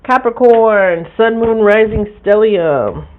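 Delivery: loud, slow, high call to Capricorn. Real, not acted. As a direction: announce the sign like a roll call. Calling out the next sign.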